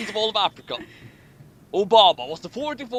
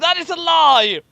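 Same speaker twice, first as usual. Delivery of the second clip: mock outraged accusation; 9 semitones higher, 8 dB louder than usual. A narrator's reading. Reads accusation dramatically.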